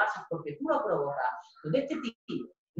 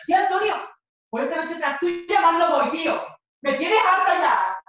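1 fed-up shout; 8 semitones higher, 7 dB louder than usual. Retold or imitated. Girlfriend running after her boyfriend, yelling high and loud.

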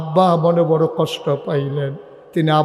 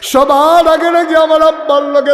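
high, loud, drawn-out cry; preacher acting a dying daughter's anguished call; wailing lament; 10 semitones higher, 6 dB louder, slower than usual. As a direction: wail it out to the congregation. Dying Fatema cries to Ali.

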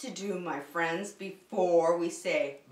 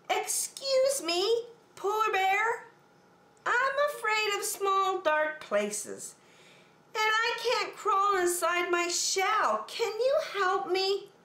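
much higher, pleading small-turtle voice; timid little turtle; 12 semitones higher than usual. Acted. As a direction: ask for help like a shy scared turtle. Franklin asks again.

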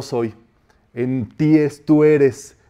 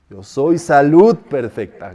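slow, with "salud" heaved out forcefully; straining effort on SALUD; 4 dB louder, slower than usual. Shown as a demonstration. Teacher likening it to lifting a jar, effortful "salud". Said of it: "como si estuvieras levantando un tarro".

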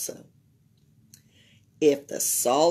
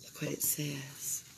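whisper; 6 semitones lower, 16 dB quieter than usual. A narrator's reading. Reader whispering the fine print to the kids. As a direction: hiss it low as if revealing a twist.

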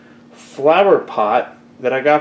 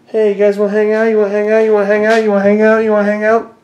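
higher, louder, insistent chant; eager pestering; 5 semitones higher, 4 dB louder, faster than usual. Real, not acted. Owner chasing the cats to hang out, eager pestering. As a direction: pester them with the same line on a loop.

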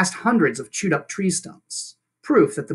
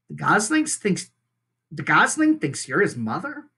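plain reading voice

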